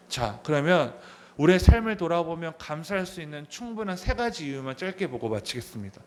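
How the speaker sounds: ordinary talk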